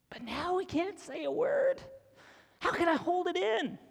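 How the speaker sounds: can't hold the secret in; excited disciples gushing to each other; bursting with excitement. Retold or imitated.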